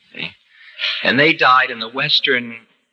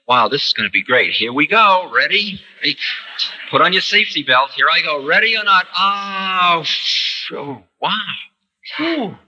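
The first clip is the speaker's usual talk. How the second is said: excited thrill; 5 semitones higher than usual. Retold or imitated. Dying person eager for the ride.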